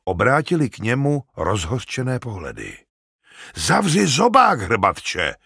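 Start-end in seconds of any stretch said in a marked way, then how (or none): 3.5-5.3 loud, high burst of anger; furious order to shut up; snapping angrily. A narrator's reading. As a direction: round on him sharply. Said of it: "osopil se na něj Tárik".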